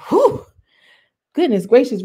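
high, loud, stunned gasp of whew, then goodness gracious; shocked whew. Retold or imitated. Recapping the show, stunned by the fight, loud and high. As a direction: react like you can't believe the fight. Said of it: "I'm like".

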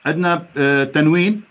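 ordinary talk

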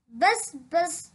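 ordinary delivery, nothing marked

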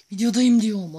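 high loud insistent shout; loud protest. Real, not acted. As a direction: yell it in protest. Protesting loudly. (yüksek sesle itiraz)

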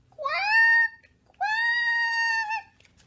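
very high, loud squawk; alarmed duck quack. Acted. Duck reacting as pig vanishes, high loud quacking.

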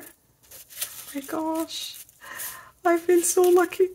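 soft, slow, high breathy awe; awed sigh. Real, not acted. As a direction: melt over it, drawn out. Awed by the images.

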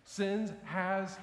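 ordinary delivery

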